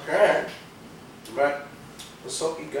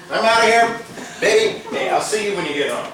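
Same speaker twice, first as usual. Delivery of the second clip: laughing exit; 9 semitones higher, 7 dB louder than usual. Real, not acted. Husband leaving, laughing flirt.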